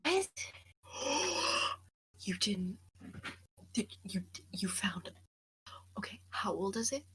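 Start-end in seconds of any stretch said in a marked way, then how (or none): 3.7-7.0 whispering. Real, not acted. Whispering so camp stays asleep.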